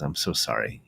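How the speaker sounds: low, soft, subdued apology; quietly crushed father apologising; grieving apology. Retold or imitated. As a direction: say it heavily, eyes on the floor. Grieving father staring at floor.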